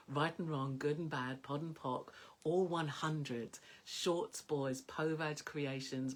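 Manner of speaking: slow, deliberate chant of the clearing statement; incantation. Shown as a demonstration. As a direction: recite it like a spell, slowly. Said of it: "I said it out loud like this".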